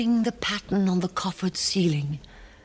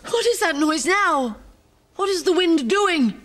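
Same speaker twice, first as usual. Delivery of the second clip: higher, louder anxious questions; panicky woman; 11 semitones higher, 3 dB louder than usual. Acted. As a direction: she jumps at every sound.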